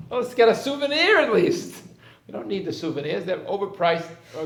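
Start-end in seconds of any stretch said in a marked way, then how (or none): 0.1-1.8 put-on high, loud childish plea; pleading child. Child to father at the park, high loud pleading. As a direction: beg like a kid who won't give up.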